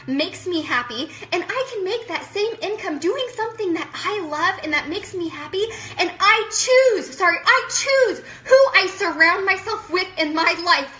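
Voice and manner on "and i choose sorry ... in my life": loud, high, tearful insistence; real emphatic declaration. Real, not acted. Telling viewers she picks her own company, loud and impassioned. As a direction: declare it loudly, hitting "choose".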